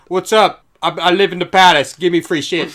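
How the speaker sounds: loud, brash and entitled; entitled loudmouth. Retold or imitated. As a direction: demand it like you own the place. Imitating an entitled squire.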